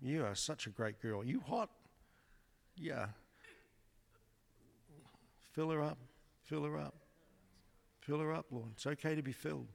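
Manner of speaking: softly encouraging the woman; tender ministering. Real, not acted. Preacher ministering to a girl, soft and gentle. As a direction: soothe her gently while praying.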